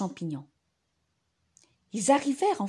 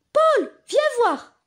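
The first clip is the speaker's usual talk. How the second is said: calling out; 13 semitones higher, 7 dB louder, slower than usual. Acted. Calling Paul.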